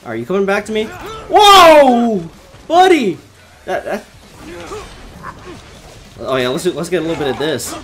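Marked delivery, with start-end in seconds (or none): 0.8-3.2 loud, high, drawn-out alarm; startled whoa. Real, not acted. Player as boss comes back at them, startled yell. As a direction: yell a long whoa, then buddy.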